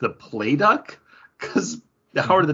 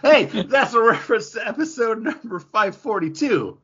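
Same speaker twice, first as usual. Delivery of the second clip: laughing; 9 semitones higher than usual. Real, not acted. Joking plug, laughing.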